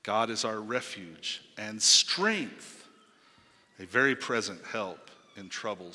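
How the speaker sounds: slow verse, strength punched loud; stress on strength. Shown as a demonstration. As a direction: lean hard on the word strength, slowly. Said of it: "putting emphasis on strength".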